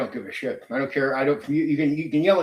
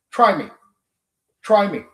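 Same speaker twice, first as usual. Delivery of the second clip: defiant taunt, pushed up; daring challenge; 5 semitones higher, 6 dB louder than usual. Real, not acted. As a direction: try me, raised and louder.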